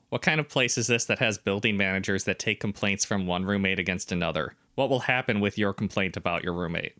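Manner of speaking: ordinary delivery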